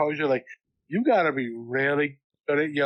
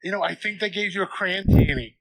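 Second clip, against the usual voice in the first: imitating her; 6 semitones higher than usual. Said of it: "she's like".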